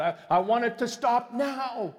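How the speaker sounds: crying out high and loud; desperate scream. Retold or imitated. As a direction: yell it out to God in despair. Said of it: "screaming out to God".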